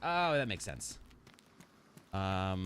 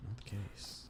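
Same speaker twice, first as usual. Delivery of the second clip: quiet mutter; 10 dB quieter, slower than usual. Real, not acted.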